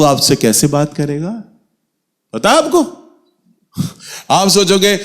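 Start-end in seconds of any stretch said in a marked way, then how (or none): none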